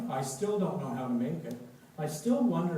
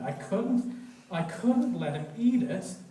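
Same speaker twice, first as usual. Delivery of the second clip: rising panic; 5 semitones higher, 6 dB louder than usual. A narrator's reading.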